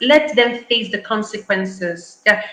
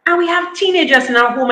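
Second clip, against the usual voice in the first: usual speaking voice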